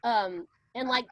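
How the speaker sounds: ordinary delivery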